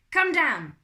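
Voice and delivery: put-on French-accented shouted order; loud, high French butler voice. A narrator's reading. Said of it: "It was a French accent".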